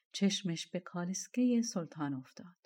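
ordinary delivery

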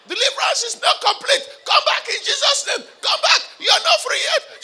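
high loud command to come back; laughing high shouted command. Retold or imitated. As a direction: order the spirit back in Jesus' name. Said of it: "I'm saying".

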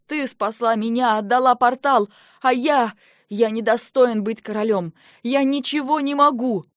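loud self-reproach; anguished outburst. Acted. Brother blaming himself before his sister, loud and anguished. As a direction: pour out guilt and rage.

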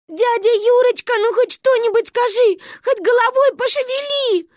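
high, loud pleading bawl; loud child wailing. A narrator's reading. Manya begging Uncle Yura to move, loud pleading bawl. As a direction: beg at the top of a child's voice. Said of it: "Громче всех орала Манюня".

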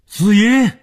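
very high, loud, drawn-out shout. A narrator's reading. Calling across the island at dawn, loud high shout. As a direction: shout the name out across the distance. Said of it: "大声的喊叫".

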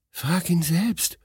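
high, slow wife answering; coy high-pitched reply, drawn out; wife shyly pushing it back to her husband; whispering. A narrator's reading. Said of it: "flüsterte Sinotschka".

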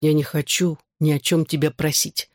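plain reading voice

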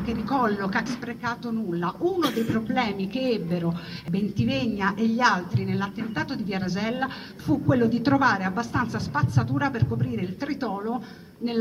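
ordinary delivery

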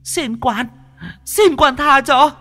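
very high, loud ghost begging for mercy; wailing ghost. Acted. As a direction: shriek and plead like a tortured spirit. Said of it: "những tiếng gào thét vang lên". (tiếng ma gào khóc van xin, cao và to)

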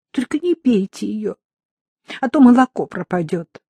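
ordinary delivery, nothing marked

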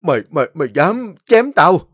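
loud stammered disbelief; stammering in shock. A narrator's reading. Wounded man to woman, shocked stammer. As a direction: stammer out in panic. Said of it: "hoảng kinh ôm lấy tay lắp bắp".

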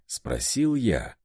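plain reading voice

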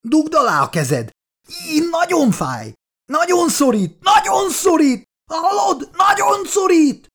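very high, agonized screaming; frantic howling. A narrator's reading. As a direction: scream in pain, climbing to a frantic pitch. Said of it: "üvöltözött már".

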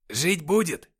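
high, loud pronouncement; rather loud. A narrator's reading. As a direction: call it out to everyone nearby. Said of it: "сказал кто-то довольно громко".

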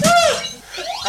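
yelp. Acted. Hit by a thrown object.

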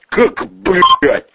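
high, choked outburst; gasping disbelief. Acted. Vetryakov hears of the escape, gasping in shock. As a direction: lose your breath in shock. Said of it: "задохнулся Ветряков".

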